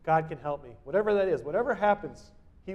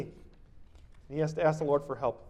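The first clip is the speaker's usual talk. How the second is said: usual speaking voice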